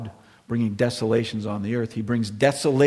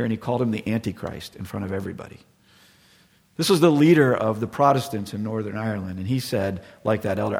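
usual speaking voice

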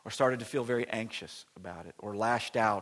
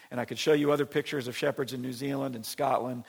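normal talking voice

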